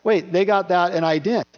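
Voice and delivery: mock protest at unfair treatment; mock outrage. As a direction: burst in with a jealous whoa.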